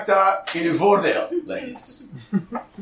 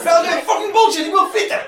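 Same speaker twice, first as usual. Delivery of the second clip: high-pitched indignant protest; mock outrage, pitched way up; acted indignant outburst; 11 semitones higher than usual. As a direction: play an outraged customer demanding food. Acting out a woman protesting no fries.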